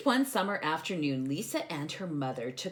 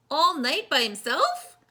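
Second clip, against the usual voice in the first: dismayed; 8 semitones higher, 7 dB louder than usual. Real, not acted. Dismay for the bear.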